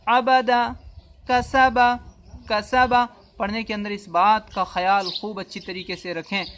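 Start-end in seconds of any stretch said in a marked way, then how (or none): 0.1-2.9 عبد and کسب read whole, slightly raised; teacher reading words aloud for pupils; fluent reading demo. Shown as a demonstration. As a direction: say کسب as one clean word. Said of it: "چلیے".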